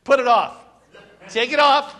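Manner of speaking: mock stern order. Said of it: "wife ordering change".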